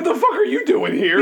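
shrill mock outrage; high-pitched yell. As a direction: squeal it out in disbelief. Repeating Burr line, high.

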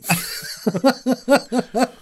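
high giggling laugh. Real, not acted. Laughing at the joke.

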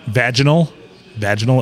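"vaginal" stressed on the first syllable, VAJ-uh-nul; "vaginal" as VAJ-in-ul, carefully corrected; corrected pronunciation. Shown as a demonstration. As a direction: say "vaginal" with a short a, like "badge". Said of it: "pointed out to me that I mispronounced".

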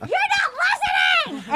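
very high, screechy mock shout; hammed-up shriek of outrage; imitated shrieking. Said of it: "And she's like".

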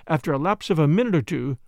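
ordinary delivery

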